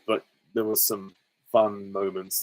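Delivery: ordinary talk, nothing marked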